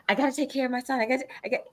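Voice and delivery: mild panic. Retold or imitated. Acts herself panicking to husband.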